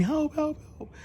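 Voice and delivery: high, small mock scream; imitated screaming for help. Canoe passengers overboard calling for rescue, mock screaming. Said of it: "they're screaming".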